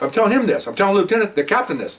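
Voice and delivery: ordinary talk, nothing marked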